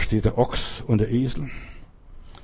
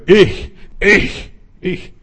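loud, high roaring boast; roaring lion; 4 semitones higher, 6 dB louder than usual. Acted. Lion roaring his claim to the angel, loud boast. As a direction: roar it like a proud lion king. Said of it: "brüllte er".

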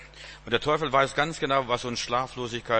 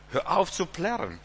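exasperated rebuke; 7 semitones higher than usual. Acted. Acted rebuke to stop wailing.

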